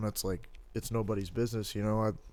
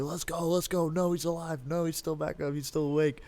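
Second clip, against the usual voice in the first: high-pitched excited fan cheering a fight; hyped; 7 semitones higher than usual. Retold or imitated. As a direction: urge the fighter on, re-enacted in a raised pitch. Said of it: "I was hype".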